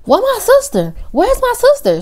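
put-on high, panicked drunk calling; panicky. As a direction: call out for her sister in panic.

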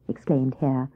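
plain reading voice

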